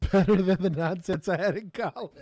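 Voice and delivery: talking through a laugh. Real, not acted. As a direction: laugh while saying it. Laughing at college ethics.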